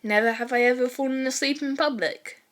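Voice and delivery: plain reading voice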